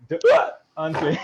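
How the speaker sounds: name broken by a hiccup; hammed-up hiccup mid-word; mock hiccup. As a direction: imitate hiccuping halfway through a name. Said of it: "your mom had a hiccup".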